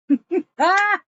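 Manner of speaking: high, loud burst of laughter; amused high laugh. Real, not acted. Reacting to the retold scene.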